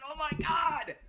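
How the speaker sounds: usual speaking voice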